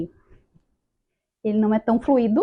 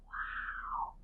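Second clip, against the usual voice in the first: awed; 17 dB quieter than usual. Retold or imitated. Amazed by the healing work.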